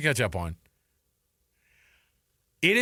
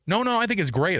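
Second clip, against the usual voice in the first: flustered backpedal; 7 semitones higher than usual. Retold or imitated. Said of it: "he responded back in English".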